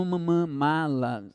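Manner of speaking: m, m, mala very slowly, the m hummed; mala, hum the m first; hummed m before mala; sounding out m. Shown as a demonstration. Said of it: "Agora é m".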